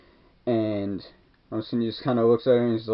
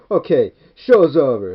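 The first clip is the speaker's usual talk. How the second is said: louder, slower order to the crowd; mock crowd command; 3 semitones higher, 8 dB louder, slower than usual. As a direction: break up the crowd with authority. Retelling cop dispersing crowd.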